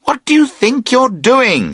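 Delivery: high, sharp call of alarm; startled high-pitched call. Acted. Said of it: "you call out".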